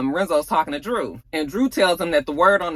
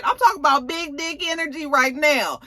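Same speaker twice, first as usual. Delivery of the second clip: ordinary talk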